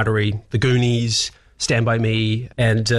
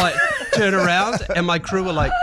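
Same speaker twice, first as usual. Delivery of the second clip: ordinary talk, nothing marked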